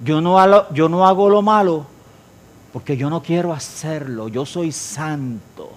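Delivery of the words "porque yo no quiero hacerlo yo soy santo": slow, much softer; hushed solemn statement; reverent. Acted. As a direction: let it settle slowly and softly. Closing the divine quote reverently.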